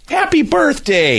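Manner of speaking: high, slow, celebratory singsong; mock cheery greeting. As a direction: imitate a gleeful well-wisher. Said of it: "it was positive somehow".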